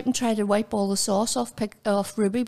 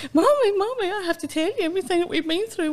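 high, fast excited dog telling mommy everything; voicing the dog; 8 semitones higher than usual. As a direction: gush it all out like an eager child. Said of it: "Ruby was going".

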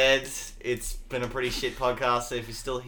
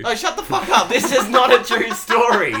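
loud, higher laughing protest; laughing outburst; 7 semitones higher, 5 dB louder than usual. Real, not acted. Host denying a friend's embarrassing made-up story, loud laughing shout.

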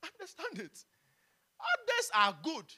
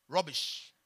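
boss's dismissal; 7 semitones lower than usual. Retold or imitated. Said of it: "he says".

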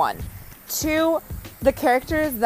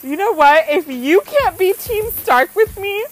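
higher, louder dramatic declaration; mock ultimatum; 7 semitones higher, 5 dB louder than usual. Wife giving husband a Game of Thrones ultimatum, hammed-up. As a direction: deliver the breakup line like a soap opera. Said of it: "I'm like".

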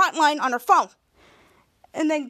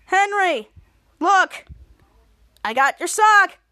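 louder, play-acted shout for attention; calling out; 4 semitones higher, 5 dB louder, slower than usual. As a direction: call out proudly to a friend. Dangling friend calls through window.

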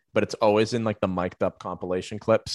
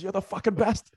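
high put-on heckler voice; mock bench chirp; 12 semitones higher than usual. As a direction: imitate bench jeering, pitched way up. Imitating player heckling from the bench.

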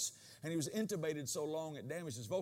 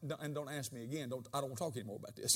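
mama ending the talk; 5 semitones lower, 5 dB quieter, faster than usual. Retold or imitated. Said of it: "you know how when mama answers you".

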